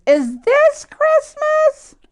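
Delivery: slow, high, wondering question; baby hippo asking in wonder; hopeful little hippo. A narrator's reading. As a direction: stretch it out full of hope. Fiona asks a third time.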